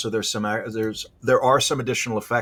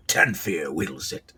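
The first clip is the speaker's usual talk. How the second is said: snarling accuser; 7 semitones lower, slower than usual. Acted. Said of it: "the first continues".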